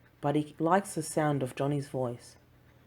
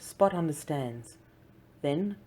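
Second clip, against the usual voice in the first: usual speaking voice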